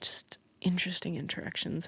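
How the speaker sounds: whispered wry aside. Real, not acted. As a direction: whisper it dryly. Trailing off sardonically.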